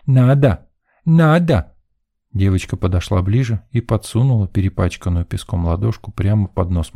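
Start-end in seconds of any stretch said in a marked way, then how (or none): 0.1-1.7 put-on high, playful child's insistence; insistent little girl. A narrator's reading. Girl insists boy take candy, high and playful. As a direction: chirp each word firmly like a tot. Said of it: "засмеялась девчонка".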